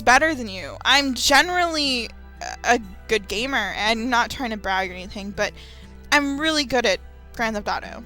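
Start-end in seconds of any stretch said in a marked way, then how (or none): none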